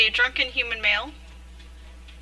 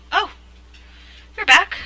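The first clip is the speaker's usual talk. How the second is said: higher, louder "oh" of recognition; mildly surprised greeting; 9 semitones higher, 6 dB louder than usual. Acted. Woman opens her door to a returning visitor, surprised. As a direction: voice lifts at an unexpected return.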